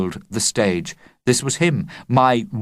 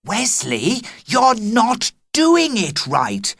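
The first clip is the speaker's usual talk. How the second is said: scolding; 11 semitones higher, slower than usual. Acted. Girl scolding onstage.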